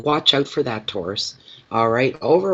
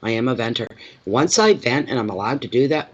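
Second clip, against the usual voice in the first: usual speaking voice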